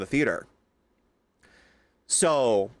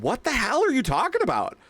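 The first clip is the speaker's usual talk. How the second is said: retold retort; 9 semitones higher than usual. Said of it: "And I said".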